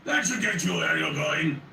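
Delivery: put-on booming French accent. A narrator's reading. Friendly giant greeting the narrator in a booming French accent. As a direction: boom it out in a cheerful French accent. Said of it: "booming French accented voice".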